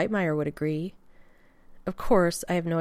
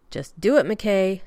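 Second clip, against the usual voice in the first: firm order; 3 semitones higher, 7 dB louder, slower than usual. Acted. John firmly orders Rodney.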